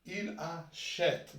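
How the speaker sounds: "achète" drawn out slowly with an open è. Shown as a demonstration. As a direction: stretch "Il achète", leaning on the open è of "achète". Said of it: "qu'est-ce qu'on va lire?".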